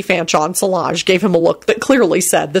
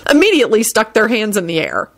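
plain reading voice

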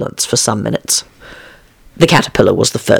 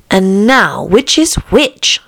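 high, slow self-questioning; wondering Alice; 7 semitones higher, much slower than usual. A narrator's reading. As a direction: puzzle it out in a raised voice.

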